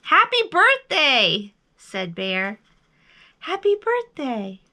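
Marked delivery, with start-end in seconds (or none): 0.0-1.5 high, loud, slow greeting; big drawn-out birthday call. A narrator's reading. Bear calls across to the moon. 3.4-4.6 high echoed greeting, less forceful; high held-back reply. A narrator's reading. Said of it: "his own voice echoed".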